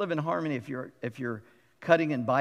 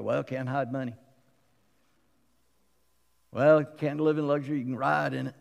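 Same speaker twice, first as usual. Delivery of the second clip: snarky. Retold or imitated. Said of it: "some snarky comment".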